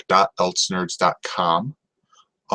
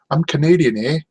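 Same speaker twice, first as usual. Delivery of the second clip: a hint of a Canadian accent, higher and louder; bad Canadian accent; 8 semitones higher, 3 dB louder than usual. Joking that she's Canadian, in a light mock Canadian accent. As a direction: play up a jokey Canadian accent. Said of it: "bad Canadian accent joke".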